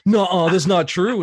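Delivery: loud, high imitation of a disbelieving kid; mock-alarmed loud denial; retold child's protest. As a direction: blurt it out like a kid refusing to believe.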